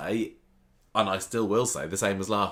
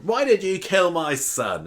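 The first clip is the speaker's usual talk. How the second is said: high, slow, louder mock demand; drawn-out, raised-voice accusing question; acted accusation; 10 semitones higher, 5 dB louder, slower than usual. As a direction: confront the killer in a hammed-up plea. Acted confrontation of son's killer.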